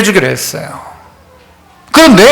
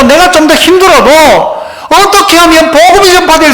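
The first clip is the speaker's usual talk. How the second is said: raised, fervent sermon voice; preaching; 6 semitones higher than usual. Acted. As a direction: preach it out to the congregation. Preaching at full force.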